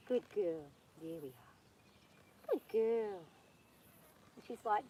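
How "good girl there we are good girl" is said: soothing horse praise. Real, not acted. Soothing the horse while mounted.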